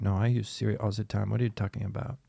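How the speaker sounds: softly imitated French-accented dad. Dad insisting to his daughter about Siri, quiet French accent. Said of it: "did you do a French accent".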